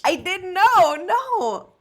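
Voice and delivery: sharp high jump of real surprise; genuinely surprised. Real, not acted. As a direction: caught off guard, voice leaping up. Learns friend was a lawyer.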